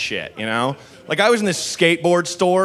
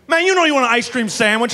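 yell across street; 8 semitones higher, faster than usual. Retold or imitated. Dude yells across street.

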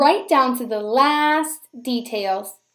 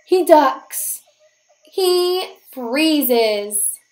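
ordinary delivery, nothing marked

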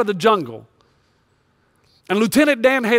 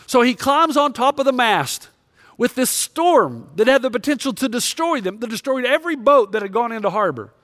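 ordinary delivery

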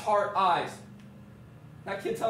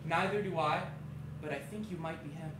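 quiet little-boy imitation, trailing softer; imitated posh kid; 4 semitones lower, 7 dB quieter than usual. Man quotes sweet kid's reply, softly imitated. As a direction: lightly mimic a small, polite kid. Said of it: "listen to this, then he go".

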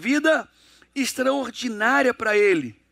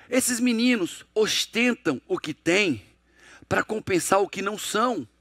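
slow emphatic maxim; slower than usual. Shown as a demonstration.

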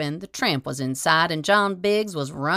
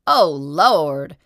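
shivering; 5 dB louder than usual. A narrator's reading. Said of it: "says the tramp with a shiver".

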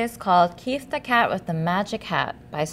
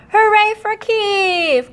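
high, loud, slow hooray; loud high slow cheer; cheering cry; 11 semitones higher, 6 dB louder, slower than usual. Acted. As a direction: cheer him with joy. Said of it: "cried the cats".

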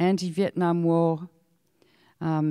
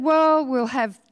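higher, louder mock politician voice; mocking Bob Hawke; 7 semitones higher, 5 dB louder, slower than usual. Speaker mimics the Prime Minister's policy to the audience, mocking. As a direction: lampoon a smug politician.

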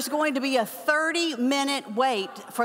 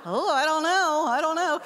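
nervous hesitation; 5 semitones higher than usual. Retold or imitated. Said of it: "I was like".

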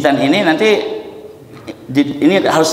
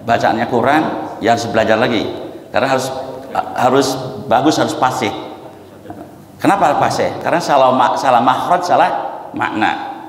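normal talking voice

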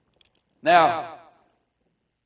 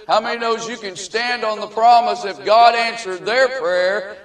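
rising sermon delivery; preaching; 5 semitones higher, 4 dB louder than usual. Acted. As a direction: build it like a preacher. Preaching to congregation.

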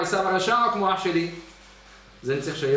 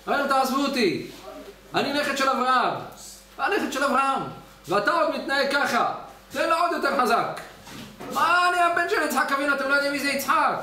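Esau shouting; 5 semitones higher than usual. Retold or imitated. Esau shouting at the whipping angels in outrage. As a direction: cry out in outrage while being whipped. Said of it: "התחיל עשיו לצעוק".

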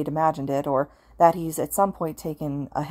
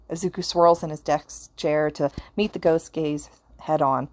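ordinary delivery, nothing marked

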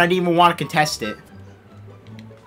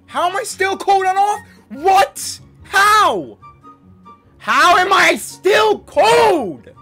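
raging gamer; 10 semitones higher, slower than usual. Real, not acted. Gamer furious at cold streak.

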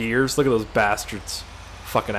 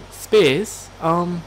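mock British commenter, pitched up; British accent; 5 semitones higher than usual. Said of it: "I don't know why they're British".

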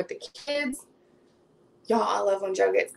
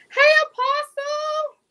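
very high, loud, slow drawn-out hello; calling out a greeting; 5 dB louder, slower than usual. Real, not acted. Host spots a viewer in the chat, calling out high. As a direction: sing out hello, stretching it high.

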